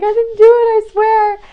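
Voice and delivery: high, loud mock-tearful protest; imitating a crying boy. A third-grade neighbor, accused, protesting through mock tears. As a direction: whine it like a panicked kid. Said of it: "he was, like, crying".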